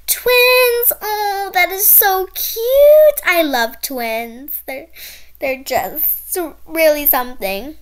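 high, loud, sing-song shopkeeper; a bubbly clerk gushing at two small customers; gushing shop lady. Acted. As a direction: coo over the kids like an overeager saleswoman. Shop lady gushes over twins.

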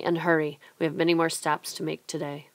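ordinary delivery

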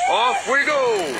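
excited cry. A narrator's reading. Race start cry.

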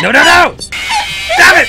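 panicked high scream. Real, not acted. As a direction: shriek it in panic. Scream at jump scare.

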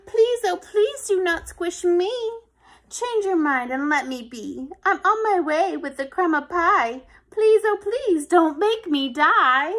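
loud, high begging little ant; pleading ant. Acted. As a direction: beg for your life. Ant begs for its life.

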